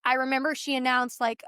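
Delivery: normal talking voice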